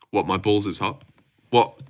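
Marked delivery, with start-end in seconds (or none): none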